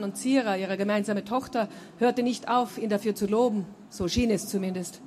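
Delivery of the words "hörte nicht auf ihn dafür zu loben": usual speaking voice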